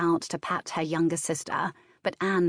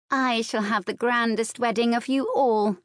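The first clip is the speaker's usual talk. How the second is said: put-on higher, breezy girlish boast; airy; 6 semitones higher, 3 dB louder than usual. A narrator's reading. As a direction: announce it airily as a young girl. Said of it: "announced twelve-year-old Margaret Saint Michael airily".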